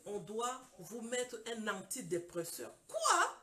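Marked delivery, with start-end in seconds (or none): 2.9-3.4 loud, high yelp of disbelief; retold shock. Patient told she needs an antidepressant, acting shocked. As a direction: blurt it out high, acting stunned.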